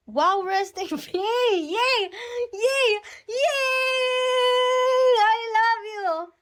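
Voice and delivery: high, loud yays; excited cheering. Real, not acted. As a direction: squeal with delight at the gift. Cheering a gift from fans. (masiglang sigaw sa tuwa)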